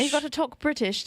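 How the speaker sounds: put-on posh British accent. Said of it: "hoity-toity accent would be".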